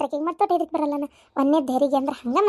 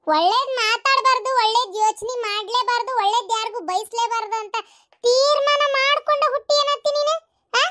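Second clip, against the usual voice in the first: high, indignant scolding, building higher at the end; angry rebuke; 6 semitones higher than usual. Acted. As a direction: rebuke the aunt with rising exasperation. Man rebukes aunt's ominous talk. (ಸಿಟ್ಟಿನಿಂದ ಏರು ದನಿಯಲ್ಲಿ ಗದರಿಸುವುದು)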